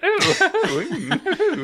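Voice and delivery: high, laughing "ooh" of a British fop; naughty British fop. Retold or imitated. Imitating the fop dub to friends, high and laughing. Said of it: "doing a naughty little British fop accent".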